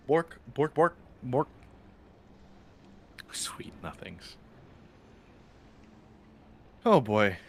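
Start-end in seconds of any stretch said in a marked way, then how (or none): 0.1-1.5 higher playful barking woofs; lightly voiced dog barks; mock dog bork. Imitating a dog noise. 3.3-4.3 slow, breathy whispered words; hushed ASMR-style whisper for chat. Shown as a demonstration. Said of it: "I'll whisper it into the mic".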